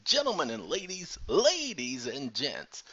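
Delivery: hammed-up showman address, slowing at the end; announcer. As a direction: call out to the crowd like an MC.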